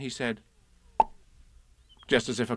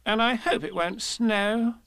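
high, chatty rabbit voice; fussy little host, pitched up; 10 semitones higher than usual. Acted. Rabbit's voice in the story.

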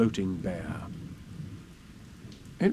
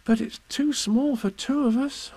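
sad; 11 semitones higher than usual. Acted. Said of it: "said Christopher Robin sadly".

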